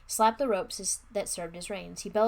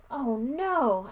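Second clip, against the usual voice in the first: higher, louder cry of dismay; 5 semitones higher, 7 dB louder than usual. A narrator's reading. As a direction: exclaim in sudden dismay. Narrator finds the hotel gone.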